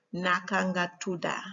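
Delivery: Gambian-accented Wolof, tuda ending in "ah". Shown as a demonstration. Teacher showing how to ask a name, Gambian accent. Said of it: "Whereas in Gambian accent".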